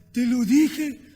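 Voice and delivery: loud, cross elephant warning; angry elephant. Acted. The annoyed elephant warns the ant loudly. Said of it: "el elefante se enfada".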